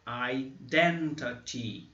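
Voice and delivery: identity very slow, ending in ee; teacher modelling the word; slow word demo. Shown as a demonstration. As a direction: stretch identity out slowly. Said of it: "som de I".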